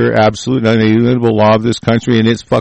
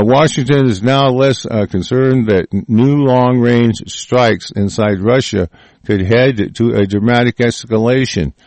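usual speaking voice

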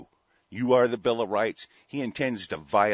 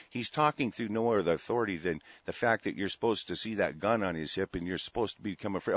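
ordinary talk, nothing marked

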